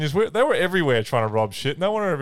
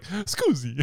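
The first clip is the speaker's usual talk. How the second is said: imitated Italian; 5 semitones higher, 5 dB quieter than usual. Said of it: "that Euro trip one where he's like".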